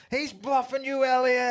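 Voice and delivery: high, loud mock urging; acted urgent shout. Film fan playing listeners coaxing Elliot from afar, calling out.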